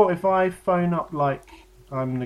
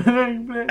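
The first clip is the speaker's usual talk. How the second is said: high ringtone noise through laughter; laughing phone-ring imitation; 8 semitones higher, 3 dB louder than usual. Friend still ringing over the story, laughing imitation. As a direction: keep ringing like a phone, giggling. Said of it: "That's a phone ringing".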